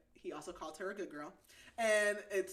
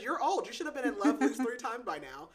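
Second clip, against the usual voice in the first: higher and louder, breaking into a laugh; mock scolding through laughter; 5 semitones higher, 7 dB louder than usual. Retelling the heroine's blunt line to a friend, laughing. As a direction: tease it, laughing as you go. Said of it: "she says".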